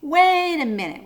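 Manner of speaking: loud high plea; urgent call. A narrator's reading. Father dangling from the tusk calls out loudly. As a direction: shout it out while dangling.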